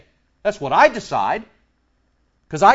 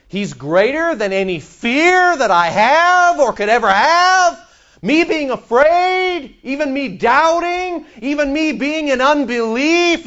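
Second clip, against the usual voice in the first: much higher, building and stretched out; building preacher's fervour; 8 semitones higher, 4 dB louder than usual. Real, not acted. Preacher declares God greater than his fears, rising fervour. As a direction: preach it high, drawing out each doubt.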